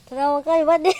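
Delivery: loud half-laughing rebuke; loud scolding. Real, not acted. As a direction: call him out for laughing. Scolding companion who laughs. (malakas na pagsaway na may tawa)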